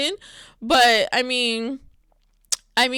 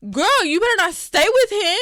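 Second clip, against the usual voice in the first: loud call to the wife on screen; 6 semitones higher, 6 dB louder than usual. Real, not acted. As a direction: shout a warning at the TV. Yelling advice at the TV character.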